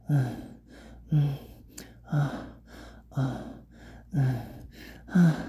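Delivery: short panting moans in steady rhythm; rhythmic moans. Acted. Narrator acting out exertion, rhythmic moans. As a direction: pant out brief falling moans.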